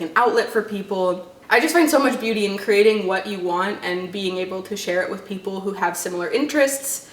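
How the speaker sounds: normal talking voice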